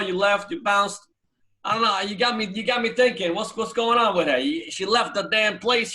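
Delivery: loud, high mock Brooklyn tough-guy accent; teasing an old Brooklyn friend in a thick Brooklyn accent. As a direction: ham up a Brooklyn street voice. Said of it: "You lost the accent a little bit".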